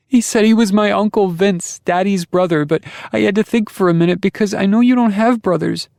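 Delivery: put-on high, loud child voice; a frightened little girl blurting it out. A narrator's reading. Daughter tells her father, high scared child voice.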